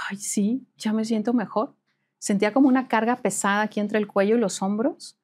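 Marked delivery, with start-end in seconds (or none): 0.1-1.7 soft breathy whisper of relief; relieved whisper. Retold or imitated. Friend whispers after the entity leaves, relieved whisper. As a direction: whisper it, drained but relieved.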